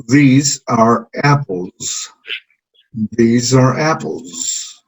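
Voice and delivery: "These are apples" very slow, stretched and falling; slow model sentence. Shown as a demonstration. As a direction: say it slowly for the class to copy. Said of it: "estamos analizando ahorita la forma del plural".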